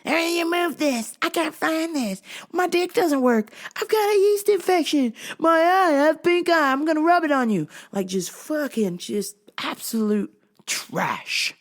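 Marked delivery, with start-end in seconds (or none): 0.0-7.7 high mocking customer whine; string of complaints in a high, imitated voice; shitty attitude. Rude customers griping at the store worker, mock shitty attitude. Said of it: "people have a shitty attitude".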